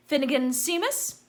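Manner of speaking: loud, high roll-call announcement; calling out names. A narrator's reading. Professor calling the next pupil loudly to the stool. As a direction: call each name across the hall.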